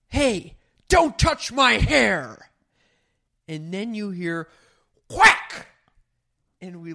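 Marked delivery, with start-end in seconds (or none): 0.1-2.5 high, drawn-out mock scream; retold scream. Retelling a woman's outburst at a party, screamed. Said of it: "this woman scream". 5.1-5.7 sudden high, sharp "quack"; imitated whack sound. Imitating the smack heard across the party, loudly.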